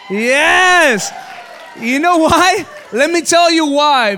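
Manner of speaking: loud, high preacher's exclaim; preaching triumph. Real, not acted. Pastor to congregation, triumphant loud preaching. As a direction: preach it to the room.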